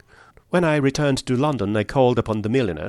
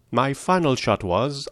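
ordinary delivery

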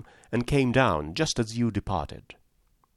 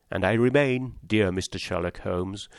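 plain reading voice

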